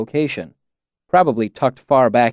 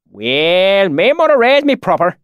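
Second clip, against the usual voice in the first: high, loud, solemn backwoods dialect; pious valley accent; 7 semitones higher, 4 dB louder than usual. A narrator's reading. Swineherd feigns piety over a drink, thick valley accent. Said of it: "the swineherd said piously".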